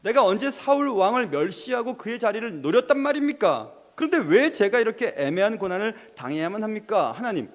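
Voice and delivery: acted anguished outcry; crying out. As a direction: demand answers as a wronged David. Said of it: "하고 지금 다윗은 외치고 있는 겁니다".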